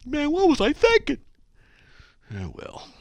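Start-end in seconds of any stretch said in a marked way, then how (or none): 0.1-1.2 high, loud mock dismay; hammed-up cry of sudden regret; imagined regretful emailer. Host imitates an emailer seeing their mistake, mock dismay. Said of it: "imitate 'em, but I don't know".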